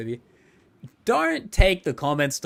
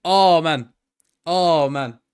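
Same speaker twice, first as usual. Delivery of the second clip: loud drawn-out oh man; excited groan; 6 dB louder, much slower than usual. Real, not acted. Streamer reacts to the exposed alt account, loud and amused. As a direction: let the discovery hit you.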